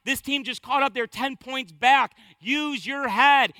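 loud, slowing coach scolding; coach yelling. Retold or imitated. Preacher voicing the Virginia coach berating his player. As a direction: hammer each word at the player. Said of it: "He's saying to his player".

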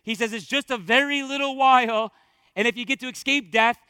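loud, driving pulpit delivery; worked-up preaching. Real, not acted. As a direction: build the urgency like an excited preacher. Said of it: "He's getting worked up".

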